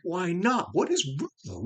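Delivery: high-pitched, puzzled self-questioning; exasperated questioning. Retold or imitated. Puzzling over the movie's missing feeling, exasperated.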